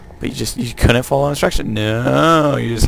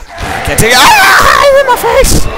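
very high, loud shriek; over-the-top victim's screech; mock scream; 9 dB louder, slower than usual. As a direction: build into a hammed-up scream. Mock scream as hit in game.

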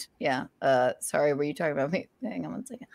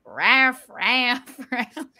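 dog bark imitation; 3 semitones higher, much slower than usual. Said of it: "It was like".

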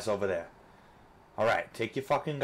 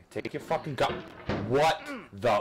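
normal talking voice

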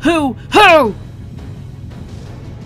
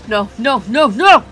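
desperate denial; 8 dB louder than usual. Acted. Giant in denial.

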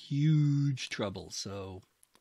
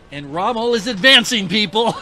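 higher and much louder, calling out the news; incredulous exclaim; 7 semitones higher, 13 dB louder than usual. Real, not acted. Player telling viewers Rommel advances alone, loud and incredulous. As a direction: announce the absurd to the audience.

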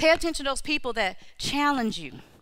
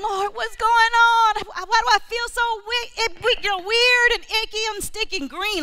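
anguished prayer; 9 semitones higher, 5 dB louder than usual. Retold or imitated. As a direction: beg the Lord for an answer, voice stretched high. Praying alone in the bathroom.

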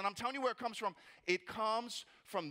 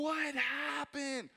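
long, drawn-out, higher cry of disbelief; bewildered outcry; 5 semitones higher, much slower than usual. Acted. Congregant losing their voice before a month of singing, bewildered. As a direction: stretch it out in acted dismay. Imagined reaction to a setback.

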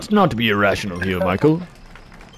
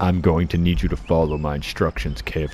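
mock seduction; 5 semitones lower, 4 dB quieter than usual. Playing the seductive leader in skit.

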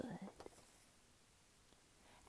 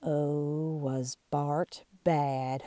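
plain reading voice

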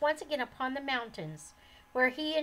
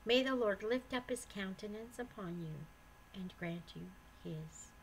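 benediction; 3 semitones lower, 7 dB quieter than usual. Acted. Reader closing the episode with a blessing, soft. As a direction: bless the listeners gently.

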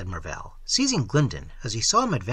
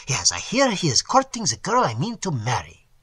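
usual speaking voice

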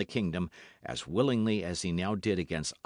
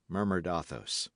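ordinary delivery, nothing marked

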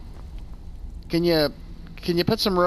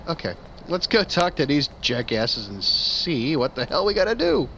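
ordinary talk

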